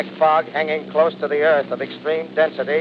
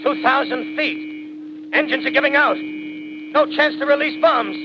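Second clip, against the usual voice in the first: higher and higher, panic climbing to a strangled end; rising terror as the plane dives; 9 semitones higher than usual. Acted. Pilot radioing the base while diving into the machines, in mounting panic. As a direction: cry out as the burning bomber dives to its death.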